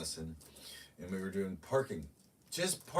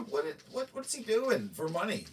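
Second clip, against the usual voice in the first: incredulous; 14 semitones higher than usual. Retold or imitated. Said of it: "I'm like".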